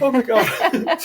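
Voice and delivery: high, laughing gasp of shock; startled laugh. Retold or imitated. Seeing a ghost where her daughter stood, startled laughing cry. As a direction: jump at the ghost, half laughing. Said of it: "I'm like".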